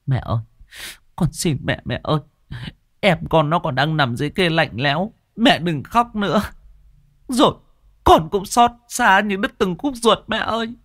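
pleading grieving daughter. A narrator's reading. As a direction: plead gently with your grieving mother. Tearful sister begs mother to stop.

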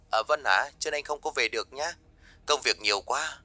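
soft, distant voice on a phone line. Acted. Husband calls wife to skip lunch, faint phone voice. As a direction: speak as if heard faintly through the receiver.

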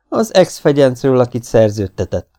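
plain reading voice

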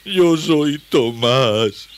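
very slow, loud, high wail; drawn-out acted tearfulness; whimpering. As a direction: wail the name out miserably. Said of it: "lloriqueó el desconocido".

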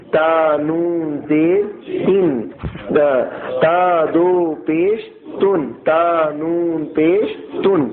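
slow, drawn-out letter-by-letter reading; slow spelling out. Shown as a demonstration. Teacher showing pupils how tanween is read, slowly spelled. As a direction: sound out each syllable slowly for learners. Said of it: "اس طرح دیکھیے".

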